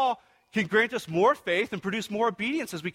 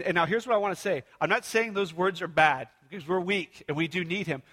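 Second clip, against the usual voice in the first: usual speaking voice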